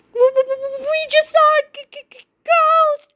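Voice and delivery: high, stuttering terror; stammering fright. A narrator's reading. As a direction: stutter it out, scared stiff. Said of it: "cried George".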